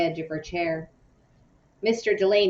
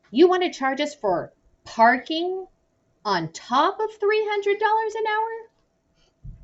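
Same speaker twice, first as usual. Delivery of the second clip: high, incredulous outraged voice; voiced Hank, incredulous and much higher; indignant outburst; 13 semitones higher than usual. A narrator's reading. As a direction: gawk at the price and shout it high. Hank indignant at lawyer's fees.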